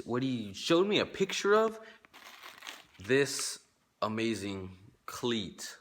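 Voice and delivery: usual speaking voice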